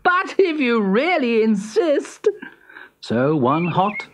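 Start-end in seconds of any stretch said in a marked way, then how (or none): 0.0-2.3 high, condescending hare voice; sneering hare. Acted. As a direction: indulge him with scorn. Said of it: "sneered Hare".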